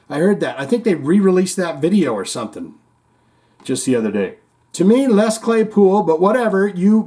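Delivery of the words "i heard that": normal talking voice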